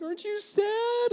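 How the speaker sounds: very high, drawn-out mock crying voice; imitated tearful wail. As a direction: wail it like a weepy girlfriend. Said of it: "tears coming down her face, her makeup's running".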